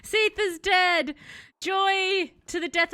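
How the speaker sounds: high, loud, drawn-out victory cry; jubilant celebration. Real, not acted. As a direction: proclaim the win slowly and joyfully. Celebrating the boss kill.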